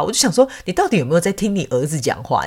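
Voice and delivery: usual speaking voice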